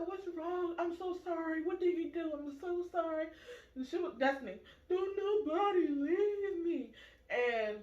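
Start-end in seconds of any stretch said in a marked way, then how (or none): 0.1-3.3 lightly voiced worried mom, pitched high; apologetic mother. Boy's mother apologizing, retold. 4.9-6.9 put-on high, slow toddler wail; mock screaming cry.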